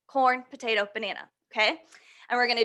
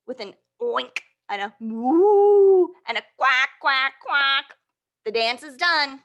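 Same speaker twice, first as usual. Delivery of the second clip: animal noises; 3 semitones higher, 7 dB louder than usual. Acted.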